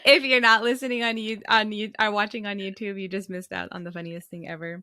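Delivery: giggling through words; laughing while talking. Real, not acted. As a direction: laugh as you speak. Laughing after a joke.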